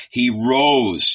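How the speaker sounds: plain reading voice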